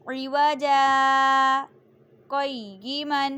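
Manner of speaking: عوجا held long with a pause, then قیما; slow Quranic recitation, stop after عوجا; saktah pause. Shown as a demonstration. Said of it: "سکتہ کرنا ہے".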